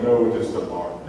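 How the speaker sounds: lightly voiced Romeo in a lovers' exchange; a hint of character voice for Romeo. Teacher plays Romeo answering about the lark, lightly voiced. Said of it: "Little Romeo and Juliet".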